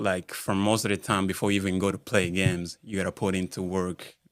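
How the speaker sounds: ordinary delivery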